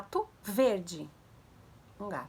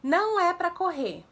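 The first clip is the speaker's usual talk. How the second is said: put-on higher, louder, drawn-out order to a child; parent's firm command; 7 semitones higher, 7 dB louder, slower than usual. Shown as a demonstration. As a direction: call out a firm warning to a child. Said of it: "você fala assim". (ordem firme a uma criança, alta e arrastada)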